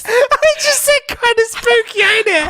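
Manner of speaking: squealing high through real laughter; can barely talk for laughing; helpless laughing. Real, not acted. Cracking up at own accent.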